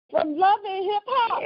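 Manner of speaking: high-pitched disbelieving question; incredulous. Retold or imitated. Friends reacting to hearing the name Yandy, incredulous. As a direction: ask it as if you cannot believe it.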